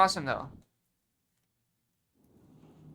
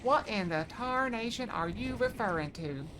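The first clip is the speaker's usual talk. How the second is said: put-on old southern drawl; 3 semitones higher, 4 dB quieter, slower than usual. Shown as a demonstration. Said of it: "the old southern accent".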